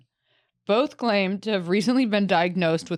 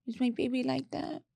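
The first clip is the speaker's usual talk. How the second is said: doting mother; 6 semitones higher, 4 dB quieter than usual. Retold or imitated. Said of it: "then she gives him a cup of warm milk".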